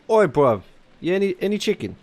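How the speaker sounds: cheeky British "bruv" voice billed as Parisian; joke "Parisian" accent. Acted. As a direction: ham up a wrong-on-purpose Parisian accent. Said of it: "You know, typical Parisian accent".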